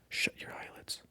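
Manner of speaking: usual speaking voice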